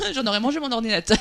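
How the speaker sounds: talking fast through laughter; amused rush of words, laughing. Real, not acted. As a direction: giggle through the joke, words tumbling out. Joking about the caterer's paella photo.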